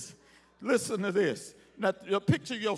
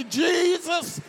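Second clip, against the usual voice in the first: higher, slow shouted plea; pleading shout; 6 semitones higher, 3 dB louder, slower than usual. Pretend. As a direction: cry out for mercy like Bartimaeus. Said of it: "Somebody ought to say".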